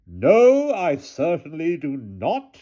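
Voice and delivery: cool, slow and superior; haughty aunt voice. A narrator's reading. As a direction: brush him off with icy calm. Hannah answers the guard.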